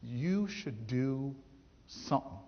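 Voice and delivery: mock-profound pronouncement, very slow; drawn out like a great revelation. The teacher, as if sharing cosmic wisdom, speaking very slowly. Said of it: "the greatest insight that ever came".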